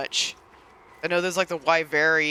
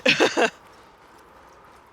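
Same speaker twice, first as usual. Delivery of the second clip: short, higher-pitched chuckle; short laugh; 9 semitones higher, 4 dB louder than usual. Real, not acted. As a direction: break into a brief, amused laugh. Amused by own cat-speak remark.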